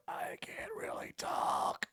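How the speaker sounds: hoarse voiceless whisper; lost voice. Retold or imitated. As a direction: rasp it out with no voice left. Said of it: "lost my voice".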